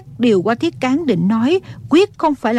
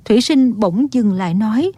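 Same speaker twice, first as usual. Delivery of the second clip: plain reading voice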